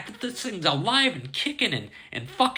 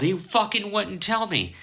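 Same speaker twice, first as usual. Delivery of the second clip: usual speaking voice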